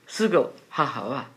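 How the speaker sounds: ordinary talk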